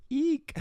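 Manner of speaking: squeaky little eek; high squeal. Retold or imitated. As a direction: squeal in cringe. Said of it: "And it's like".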